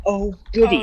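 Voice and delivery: put-on high voice, dripping sarcasm; sarcastic mock delight. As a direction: feign joy at dreadful news. Sarcastic reaction to twelve mile run.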